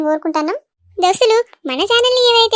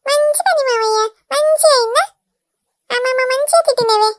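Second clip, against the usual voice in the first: put-on higher, gloating kid voice; taunting child; 5 semitones higher than usual. Nephew gloating at the scolded uncle, high and cheeky.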